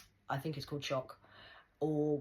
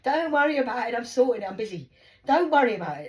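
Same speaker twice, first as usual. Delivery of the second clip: loud, high insistence; insistent brush-off; 8 semitones higher, 12 dB louder than usual. Retold or imitated. Mum fending off worried daughter, loud insistent brush-off. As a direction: shut the questions down loudly.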